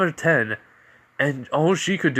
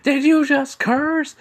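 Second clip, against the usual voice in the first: high, loud, slow shriek; scandalized parent; 8 dB louder, slower than usual. Acted. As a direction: shriek it slowly like an outraged parent. Parent mode activates, scolds him.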